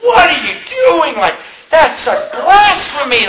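high, slow scandalized outcry; outraged at the blasphemy; friends flipped out. Retold or imitated. Teen friends scolding the joker, scandalized outcry. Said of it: "all my friends just flipped out".

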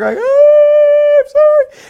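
very high, whiny mock child voice. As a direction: squeak it like a sorry little kid. Child crying, voiced mockingly.

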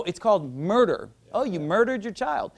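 ordinary talk

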